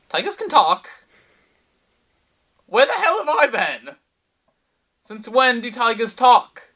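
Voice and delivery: loud, high-pitched disbelief; incredulous outburst. Acted. Man reacting to a tiger that just talked, incredulous outburst. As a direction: cry out in utter astonishment at this.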